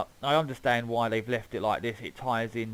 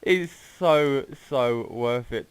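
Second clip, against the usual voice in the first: laughing; 4 semitones higher, 9 dB louder, slower than usual. Real, not acted. Laughing after crash.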